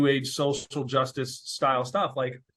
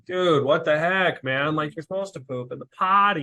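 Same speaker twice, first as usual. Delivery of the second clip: exasperated dad scolding; 5 semitones higher, 4 dB louder than usual. Retold or imitated. Dad griping at his son over pooped pants, exasperated. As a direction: let the frustration spill out at the kid.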